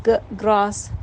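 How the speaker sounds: گ alone first, then گراس; sounding out. Shown as a demonstration. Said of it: "اس طرح سے آپ گ".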